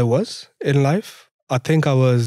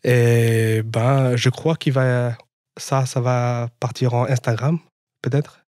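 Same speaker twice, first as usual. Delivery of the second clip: casual native French, on request; speaking French. Shown as a demonstration. Guest shows the host his French for the podcast, speaking French. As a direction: improvise a relaxed podcast intro in French. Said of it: "ask you to speak one or two lines of French".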